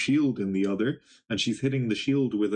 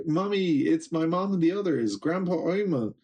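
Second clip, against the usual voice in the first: put-on much higher, delighted child voices; joyful kids; 6 semitones higher than usual. Kids spotting their mothers, high joyful call. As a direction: cry out like overjoyed little kids.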